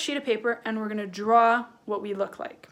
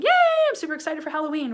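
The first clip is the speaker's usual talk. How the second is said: high, rapid-fire excited cheer; super excited; 5 semitones higher, 5 dB louder, much faster than usual. Shown as a demonstration. Teacher acting excited for Halloween, excited cheer. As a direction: gush it in one quick, thrilled rush. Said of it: "when I'm really excited".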